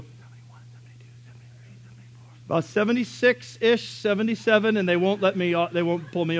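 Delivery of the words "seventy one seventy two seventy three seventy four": hushed counting up the speeds; whispered. Retold or imitated. Kid sneaking up the speedometer, whispering. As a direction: whisper it like a secret thrill.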